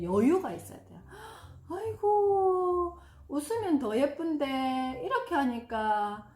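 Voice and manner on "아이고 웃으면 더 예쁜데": drawn-out, high, doting 아이고; doting coo. Shown as a demonstration. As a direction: coo at a fussing child, slowly. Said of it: "웃으면서 넘어갈 수 있는 여유". (떼쓰는 아이를 달래는 다정한 목소리)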